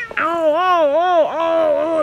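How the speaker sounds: high, loud startled oh-oh-oh; startled squeals. Real, not acted. Owner squealing as the cat swats at her, startled. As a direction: yelp as the cat swipes.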